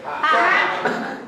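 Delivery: a sharp, high mocking Ha!; scornful ha. Shown as a demonstration. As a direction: spit out a scoffing laugh. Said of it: "Can you do that with me".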